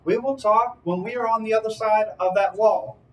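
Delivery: high, projected declaration; in-character demand. Acted. Player's character setting terms for parley, high firm declaration.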